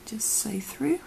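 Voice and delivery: soft unvoiced whisper. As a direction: whisper each step gently. Whispered craft tutorial.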